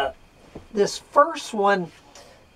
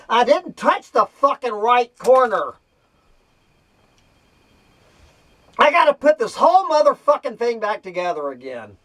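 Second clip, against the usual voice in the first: pitched way up, swearing at the machine; real frustrated rage; 6 semitones higher than usual. Real, not acted. Vlogger venting at his crashed computer, furious and pitched up. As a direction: boil over at a computer that keeps failing. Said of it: "I'm sick and tired of this fucking bullshit".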